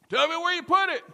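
high, loud demand; Frozone shouting. Acted. Husband pressing his wife for answers, shouting. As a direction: yell impatiently across the house. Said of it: "that Frozone had".

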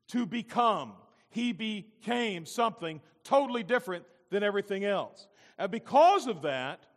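loud, high, slow preacher's proclamation; full-force preaching. Acted. Pastor expounds the creation verse to his congregation, preaching at full force. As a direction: preach it out, drawing out the key words.

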